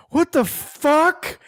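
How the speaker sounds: high, drawn-out startled "what the"; imitating flipped-out girl. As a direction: mimic her freak-out, stretched out and high. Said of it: "she's, like, flipped out".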